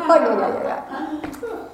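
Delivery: laughing, dismissive imitation; mock scoffing. Coach reacting to newcomer's thin experience, mock scoffing. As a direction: brush him off with a mocking laugh.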